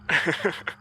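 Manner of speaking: high, quiet sinister chuckle; cold laugh. A narrator's reading. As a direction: let out a cold little laugh. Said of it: "Summers laughed the same cold laugh".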